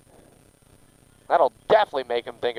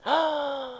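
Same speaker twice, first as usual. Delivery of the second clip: delighted gasp; 12 semitones higher, 8 dB quieter than usual. Real, not acted. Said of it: "had to channel my inner baby talk".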